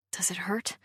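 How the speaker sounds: soft unvoiced whisper. A narrator's reading. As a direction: whisper it with worry. Hushed concern while hiding.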